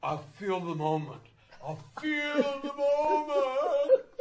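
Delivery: high, slow, airy mock voice; mock the arty dance teacher; drawn-out high mocking tone; dreamy choreographer. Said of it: "she was from the school of".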